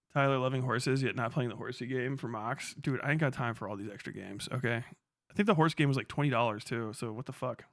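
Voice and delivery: ordinary talk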